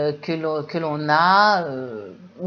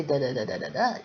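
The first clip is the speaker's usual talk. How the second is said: monotone; 6 semitones lower, 6 dB quieter, faster than usual. Shown as a demonstration. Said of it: "C'est pas comme le français".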